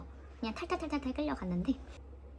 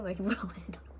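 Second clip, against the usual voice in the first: ordinary talk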